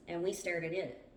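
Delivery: ordinary delivery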